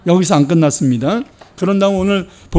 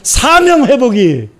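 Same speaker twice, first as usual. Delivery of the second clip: louder, higher impassioned sermon; fervent preaching; 4 semitones higher, 4 dB louder than usual. Real, not acted. Pastor exhorting the congregation, fervent preaching. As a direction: drive the message home.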